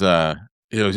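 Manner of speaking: usual speaking voice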